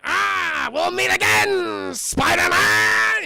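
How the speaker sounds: high, loud, slow villain cry; Green Goblin taunt. Retold or imitated. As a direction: stretch it out as a gloating parting threat. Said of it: "we get the line".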